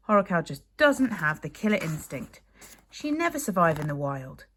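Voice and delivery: lightly voiced exasperated boy; a hint of a fed-up boy; Fishlegs voice.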